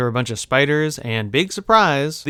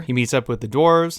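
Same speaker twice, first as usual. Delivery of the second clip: ordinary talk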